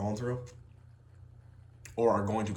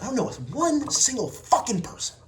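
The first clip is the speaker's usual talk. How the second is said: heated rant; 13 semitones higher, 4 dB louder than usual. Real, not acted. Heated rant about sales.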